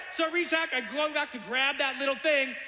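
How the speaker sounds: ordinary delivery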